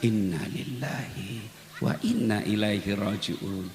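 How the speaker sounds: slow, low and unhurried recitation; low, drawn-out prayerful recitation; slow, heartfelt recitation. Shown as a demonstration. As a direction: draw out every syllable, hand on the chest.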